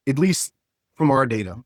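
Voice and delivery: normal talking voice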